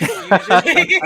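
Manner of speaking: word dissolving into high laughter; real loud cackling laughter; laughing. Real, not acted. Laughs at own admission.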